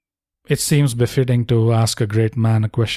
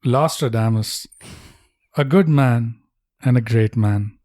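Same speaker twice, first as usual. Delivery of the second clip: solemn tribute voice; 2 semitones lower, slower than usual. Acted. Slow mystical tribute voice.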